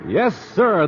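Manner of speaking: usual speaking voice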